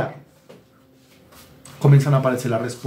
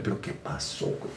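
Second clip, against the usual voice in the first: whispered, much softer "¿Pero qué pasó, güey?"; whispered aside; 12 semitones lower, 14 dB quieter than usual. Retold or imitated. Gloria whispering back, hooked on the gossip. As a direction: breathe it like a nosy secret. Gloria answering in the acted call.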